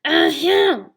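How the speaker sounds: loud, booming lion throat-clear; loud rumbling lion ahem. Acted. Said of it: "A rumbling voice from the scrub".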